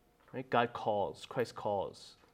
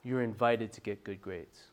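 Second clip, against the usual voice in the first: soft, lower-pitched offer; gentle invitation; 6 semitones lower, 9 dB quieter than usual. Shown as a demonstration. Preacher offering invitation instead of expected grades, softly inviting. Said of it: "instead of being expected".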